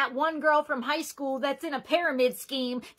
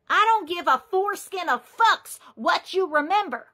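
ordinary talk, nothing marked

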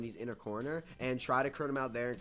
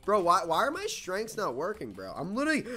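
loud, high-pitched protest; frustrated complaint; 7 semitones higher, 7 dB louder than usual. Real, not acted. Gamer whose strengths are not working, frustrated complaint. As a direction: demand an explanation, voice climbing. Strengths fail mid-game.

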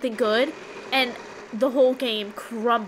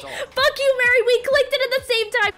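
high-pitched furious screaming; frantic, angry high yell; outraged protest; 12 semitones higher, 5 dB louder than usual. Real, not acted. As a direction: screech that you've been robbed. Rival buzzes in simultaneously.

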